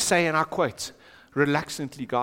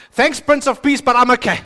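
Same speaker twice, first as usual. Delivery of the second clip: brushing off God; 5 semitones higher, 9 dB louder than usual. Acted. Preacher acts out self-reliant believer.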